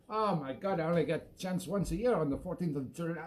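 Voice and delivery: ordinary talk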